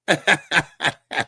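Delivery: real rolling laugh. Real, not acted. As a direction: burst of loud chuckles. Laughs at his food joke.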